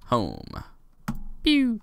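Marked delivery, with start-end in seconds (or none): 1.4-1.8 high mock zap noise; a quick pew like a laser; pew sound effect.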